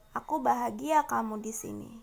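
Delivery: ordinary talk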